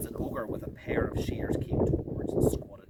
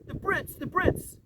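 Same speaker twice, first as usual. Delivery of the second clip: high, crying out in grief; tearful cry. Acted. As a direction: cry out in distress. Lucy crying out.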